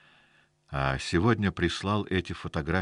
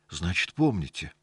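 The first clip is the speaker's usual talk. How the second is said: ordinary delivery, nothing marked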